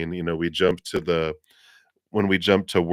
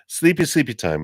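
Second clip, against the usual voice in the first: playful sing-song; 7 semitones higher, 3 dB louder than usual. Pretend. Playful aside about napping.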